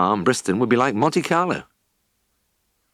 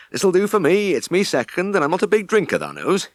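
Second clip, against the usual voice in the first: shy Yorkshire farmhand; 10 semitones higher than usual. A narrator's reading. Ned politely refusing a drink, shy Yorkshire voice. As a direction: mumble it modestly.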